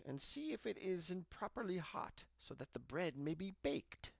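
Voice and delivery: high, sly coaxing witch; high and sly, luring the girl to the oven. A narrator's reading. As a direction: tempt her sweetly toward the fire.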